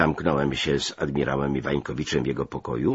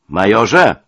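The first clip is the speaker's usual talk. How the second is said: loud, raised cry of protest; indignant shout; 4 semitones higher, 11 dB louder than usual. A narrator's reading. Officer protests to his major, shouting. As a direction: shout the rank in outrage. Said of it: "krzyknąłem".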